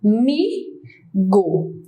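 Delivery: very slow "Amigo", a, mi, go; three separate beats on "Amigo" with pauses between; split into syllables. Shown as a demonstration. Teacher counts three impulses, "Amigo" slowly syllable by syllable. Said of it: "eu tenho três impulsos aí".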